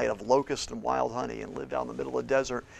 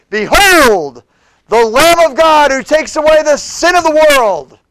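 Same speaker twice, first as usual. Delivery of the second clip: Behold the Lamb of God shouted high and loud; shouting Behold; 21 dB louder, slower than usual. Acted. Preacher shouting John's announcement of Jesus, full voice. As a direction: cry it out like a prophet to thousands. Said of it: "It's like he shouted it".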